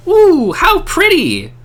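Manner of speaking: put-on high, slow wonder-struck child; delighted child. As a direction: coo like an amazed little girl.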